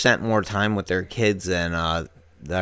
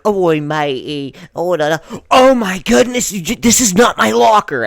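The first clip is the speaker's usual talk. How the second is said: higher, louder mock British panic; imitated British accent; 10 semitones higher, 7 dB louder than usual. Girl shut in a locker by her friend, panicky mock British accent. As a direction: put on a hammed-up British accent, building to alarm. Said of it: "doing a British accent".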